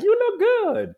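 high, gushing you look good; mock the people gushing over him; imitating admirers. Admirers gushing a compliment at the speaker, high and bright.

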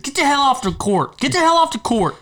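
high-pitched mock yell at the kids; retold angry holler, pitched high; imitating cussing dad. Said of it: "Monk or Dave was cussing at us".

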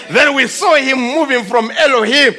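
usual speaking voice

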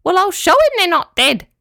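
high, loud squeaky determination; tiny yabby out to prove it; squeaky little creature. A narrator's reading. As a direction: squeak it like a feisty crab.

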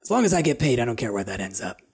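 lightly voiced sneaky lizard folk; sly thief voice.

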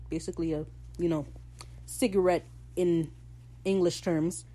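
usual speaking voice

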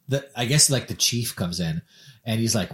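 ordinary talk

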